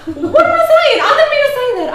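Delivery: high, loud giddy exclamation; flustered self-correction. Acted. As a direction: take it back in a laughing fluster. Flustered, taking back rude remark.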